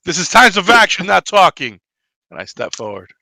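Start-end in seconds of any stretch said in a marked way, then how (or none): 0.0-1.8 high, loud commanding yell; angry shout. Acted. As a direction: bark orders at the party. Character snaps at the nun.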